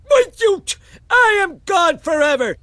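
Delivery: wounded, tearful loud exit; hurt shout. Acted. Grandpa storms off crying.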